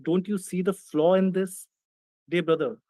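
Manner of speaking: ordinary delivery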